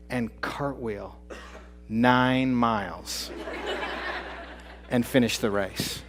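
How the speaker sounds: very slow, each word weighed; slow emphasis. Real, not acted. To the audience, marvelling, slowly weighted. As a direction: draw out cartwheel and nine miles.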